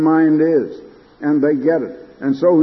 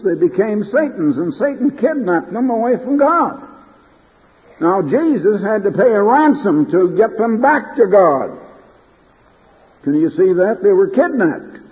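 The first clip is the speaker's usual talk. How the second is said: raised sermon voice, pressing the point; emphatic preaching; 5 semitones higher than usual. Real, not acted. Preacher explains the ransom to listeners, emphatic preaching. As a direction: preach it with a lifted, insistent pitch.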